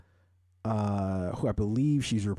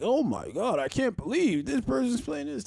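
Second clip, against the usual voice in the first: mocking shocked fans; 14 semitones higher than usual. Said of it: "and they're like".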